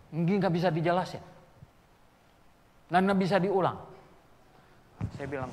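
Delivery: usual speaking voice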